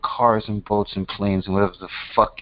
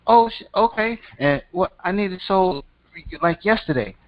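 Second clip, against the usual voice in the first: high, eager seller voice, much higher; anxious house seller; 8 semitones higher than usual. Retold or imitated. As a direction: play the seller desperate to sell fast. Seller panicking to sell house.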